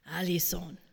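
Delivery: low rasping grandfather voice; deep gravelly voice. Retold or imitated. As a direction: rasp the name out deep and rough. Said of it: "he says this in a really deep and gravelly voice".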